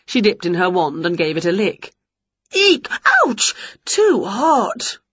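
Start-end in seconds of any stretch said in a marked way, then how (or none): 2.5-5.0 sharp high shrieks; pained yelp. A narrator's reading. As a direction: yelp from a burnt tongue. Winnie licks hot jam.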